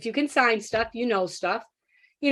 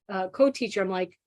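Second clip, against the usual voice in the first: ordinary talk, nothing marked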